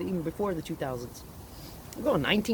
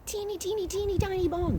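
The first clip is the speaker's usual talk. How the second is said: very high, tiny cutesy voice; tiny squeaky voice; 14 semitones higher than usual. Retold or imitated. Tiny bone told in cute voice.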